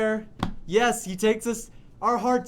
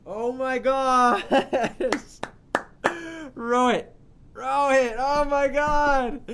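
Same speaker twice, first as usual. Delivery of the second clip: loud, drawn-out amazed gasp; overwhelmed with delight; gushing awe; 5 semitones higher, 5 dB louder, slower than usual. Real, not acted. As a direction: rave over the player in disbelief. Raving over performer.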